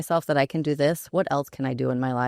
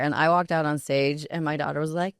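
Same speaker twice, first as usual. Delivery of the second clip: ordinary talk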